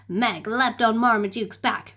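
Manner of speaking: ordinary delivery, nothing marked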